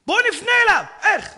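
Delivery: high loud call; shouting soldiers; urgent shout. Retold or imitated. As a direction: call out over the noise of battle. Soldiers calling in tank.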